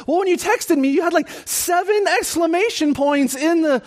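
high-pitched mock-upset woman's voice; freaked-out caller. Lady explaining her panic to pastor, mock hysterical. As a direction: imitate a distraught caller explaining herself, voice way up. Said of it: "I was so freaked out".

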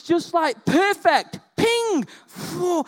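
hammed-up awe at the surgery; awestruck wonder. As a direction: gush like you saw a miracle.

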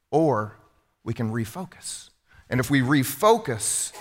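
ordinary delivery

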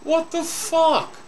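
high, drawn-out disbelief; incredulous outburst. Real, not acted. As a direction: stretch it out high in sheer disbelief. Incredulous reaction to the rezoning.

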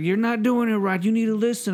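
high, exasperated mock scolding; mad scammer. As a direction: imitate an irritated scammer losing patience. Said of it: "started to get mad".

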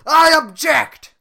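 slow, loud shouted objection; Shrek shouting. Acted. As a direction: burst in and yell it. Shrek bursts into the wedding.

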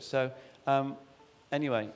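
normal talking voice

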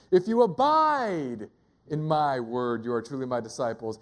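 long rising-falling "abide" before a low finish; stress on abide. Shown as a demonstration. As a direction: swell on "abide", pause, then drop low. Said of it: "emphasize different words".

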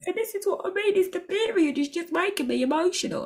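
playfully pretending to be overcome; mock emotional. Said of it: "I'm joking, I'm really not emotional".

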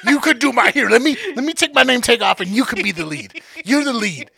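high, loud mock rant, half laughing. Retelling ranting to girlfriend about coworker, loud and mocking. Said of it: "I was like".

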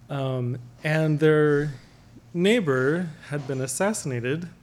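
usual speaking voice

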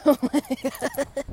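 slow, high amused gasp; amused awe. Real, not acted. Owner amused by her running dog, slow amused gasp. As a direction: marvel at her funny face.

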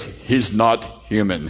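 low, very slow, awed verdict; grave Russian boxer. Retold or imitated. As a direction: spell it out slowly in disbelief. Exhausted Russian boxer voiced, awed.

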